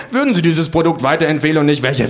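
high-pitched frantic interviewer; doomed pollster. A narrator's reading. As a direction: hurry out the question before the mower hits. Pollsters survey while being mown down.

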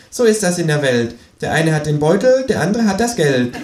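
high, smug Kangaroo voice. Acted. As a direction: deliver the rhyme like a smug know-it-all Kangaroo. Känguru voiced, smug rhyme.

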